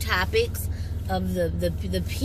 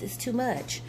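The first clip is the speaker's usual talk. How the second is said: ordinary talk, nothing marked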